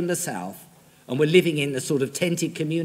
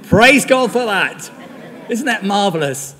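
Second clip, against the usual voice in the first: loud, high, laughing praise; laughing with joy; 5 semitones higher, 7 dB louder than usual. Real, not acted. Preacher laughing over the punchline, much higher and louder. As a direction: laugh it out in delight.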